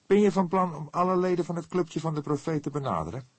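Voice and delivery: put-on anxious young detective asking; worried young man. A narrator's reading. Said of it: "vroeg hij zorgelijk".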